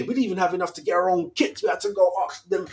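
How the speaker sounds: ordinary delivery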